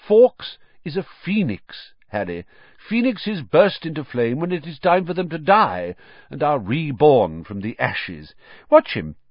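put-on lilting elderly wizard teacher; Dumbledore, explaining fondly. A narrator's reading. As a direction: explain it to a pupil with relish.

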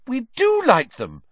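high, loud, overeager lie; fake reassurance. A narrator's reading. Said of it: "lied Hermione at once".

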